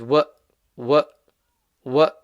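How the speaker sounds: wa cut off by a hamza from the bottom of the throat; hamza stop. Shown as a demonstration. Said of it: "the hamza is from the bottom".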